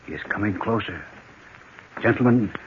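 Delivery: low and careful, the killer is right outside; hushed tension. Acted. Murderer at the door.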